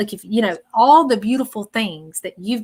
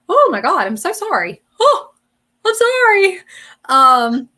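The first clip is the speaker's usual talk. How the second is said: overcome with emotion; 10 semitones higher, 9 dB louder than usual. Real, not acted. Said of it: "if I get emotional".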